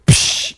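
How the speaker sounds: imitated water splash; sharp voiceless "psh"; splash noise. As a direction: make the whoosh of thrown water. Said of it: "and went".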